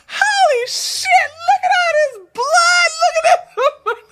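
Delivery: squealed mock horror; shocked exclamation. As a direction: imitate horrified shock while cracking up. Repeats acted shock, laughing.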